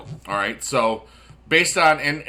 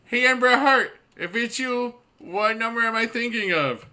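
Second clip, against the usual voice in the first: silly high voice; 10 semitones higher than usual. Acted. Silly teasing opener to chat.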